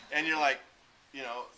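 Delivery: ordinary delivery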